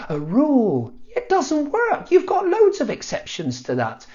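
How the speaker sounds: mock-triumphant then deflated, high; sarcastic inner voice. Young learner mocks mum's spelling rule, sarcastic and high. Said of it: "And I thought".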